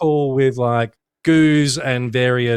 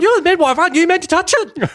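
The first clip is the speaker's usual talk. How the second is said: much higher and louder, teasing squeal; mock wife retort; 4 dB louder than usual. Mocking the wife's imagined retort.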